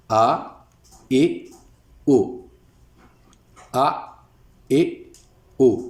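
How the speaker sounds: slow, drawn-out आ, ए, ओ, each vowel held; Urdu vowel demo. Shown as a demonstration. Teacher sounding out Urdu vowels for students, slow and clear. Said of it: "इसको पढ़ना है हमने".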